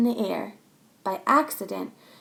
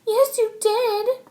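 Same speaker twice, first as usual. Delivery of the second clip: much higher, slow accusing cry; shrill retort; 12 semitones higher, 5 dB louder, slower than usual. Acted. Chloe cries back at Max, high. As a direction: fire it back. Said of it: "Chloe cried".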